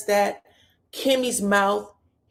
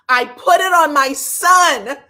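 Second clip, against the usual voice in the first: louder, higher, slower oath; emphatic; 7 semitones higher, 10 dB louder, slower than usual. Retold or imitated. Destiny swearing to Tisha, loud and slow. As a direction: swear it hard the second time. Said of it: "repeat louder".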